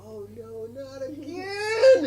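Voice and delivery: high, slow drawn-out wail; imitating hurt child. Parent retelling son's fall, high slow wail. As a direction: wail the words like a kid who fell. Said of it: "When he said".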